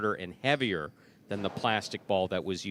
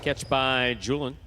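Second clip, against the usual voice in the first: loud, higher-pitched live match call; play-by-play; 5 semitones higher, 4 dB louder than usual. Real, not acted. Announcer calling game three to the crowd, live play-by-play. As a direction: project each call like an arena announcer.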